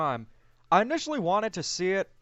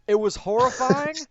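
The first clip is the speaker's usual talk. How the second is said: emphatic horror; 7 semitones higher, 6 dB louder than usual. Real, not acted. Reacting to the Sonic design reveal.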